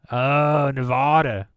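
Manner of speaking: normal talking voice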